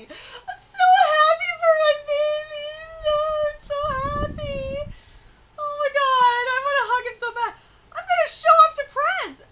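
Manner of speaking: high, squealing, proud gushing; gushing joy. Real, not acted. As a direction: gush like an overjoyed parent. Gushing over a beloved character.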